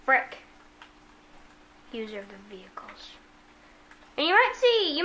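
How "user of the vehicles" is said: hushed whisper to himself. Real, not acted. Gamer whispering a pickup name to himself. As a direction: breathe it out under your breath. Muttering to himself while gaming.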